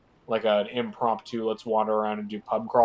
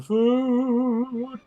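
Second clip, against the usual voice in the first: mock whine; 11 semitones higher than usual. Whining about snacks.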